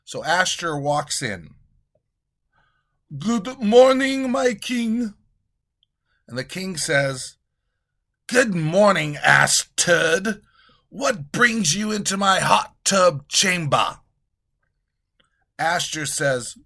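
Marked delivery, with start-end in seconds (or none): 3.2-5.1 high, slow fawning courtier; high, slow courtier greeting. Acted. 8.3-14.0 loud, haughty king; loud, haughty king in his tub; pompous king. Acted. King voiced in skit.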